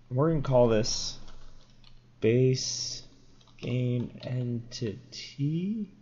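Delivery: ordinary talk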